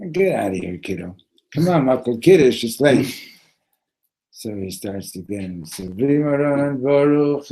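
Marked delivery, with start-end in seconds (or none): 0.1-3.3 loud, hurrying brush-off, building; impatient dismissal. Retold or imitated. Friend brushes off young rabbi. 5.6-7.5 loud, slow, raised blessing; intoned blessing. Retold or imitated. Makel starts Kiddush over the wine, loudly intoning.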